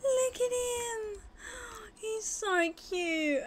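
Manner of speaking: high, slow adoring coo; doting mother's gush. Real, not acted. Gushing over baby sim.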